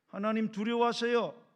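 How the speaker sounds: loud, emphatic admonition; stern warning. Acted. Believer telling a blasphemer to fear God, loud and stern.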